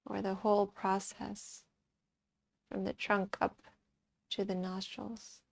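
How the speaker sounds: soft, calm meditation voice; guided meditation. Acted. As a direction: guide them quietly into watching the breath, with long pauses. Leads a breath meditation.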